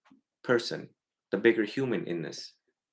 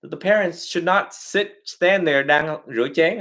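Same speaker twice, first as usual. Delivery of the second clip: ordinary talk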